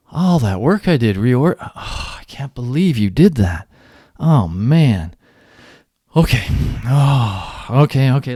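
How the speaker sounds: mock the upset experimenter, loud; exasperated outburst. Experimenter blaming participant, exasperated and loud. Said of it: "the experimenter then looks at you and says".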